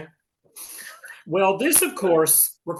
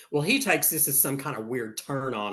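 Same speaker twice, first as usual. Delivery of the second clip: normal talking voice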